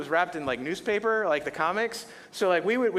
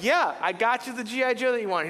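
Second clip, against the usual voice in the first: proud and eager; 7 semitones higher than usual. Retold or imitated. Proud giver retelling.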